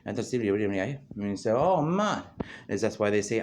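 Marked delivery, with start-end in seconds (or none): 1.5-2.2 high, loud exclamation; hammed-up astonished outburst; mock amazement. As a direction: exclaim it as a put-on big realization. Acted reaction to a revelation.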